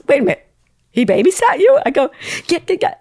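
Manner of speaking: high, loud astonished back and forth; retold excitement. Friend on the phone asking if he babysat her. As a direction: pitch it up in disbelief.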